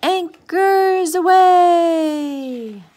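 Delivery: long, high, drawn-out sailor's cry; triumphant drawn-out shout; calling out. A narrator's reading. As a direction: sing it out loud across the deck. Sea captain's call at setting sail.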